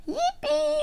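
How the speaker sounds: very high, sing-song cheer; Peppa cheering. A narrator's reading. Teacher reads Peppa's cheer to learners, joyful high squeal. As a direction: squeal it like a delighted little character. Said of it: "Cheers Peppa!".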